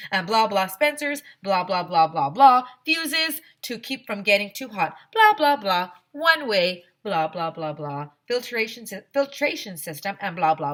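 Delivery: dismissive mock rambling; mock droning explanation. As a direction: make fun of the boring lecture. Mocking a long explanation.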